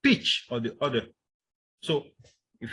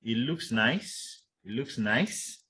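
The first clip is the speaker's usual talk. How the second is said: slow, with "nice" sliding up doubtfully; unstated reservation; 3 dB quieter, much slower than usual. Shown as a demonstration. Teacher showing students how to hint doubt, unstated reservation. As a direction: hold back judgement, letting "nice" rise. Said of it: "make use of, uh, unstated reservation".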